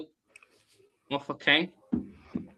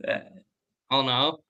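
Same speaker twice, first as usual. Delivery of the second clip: usual speaking voice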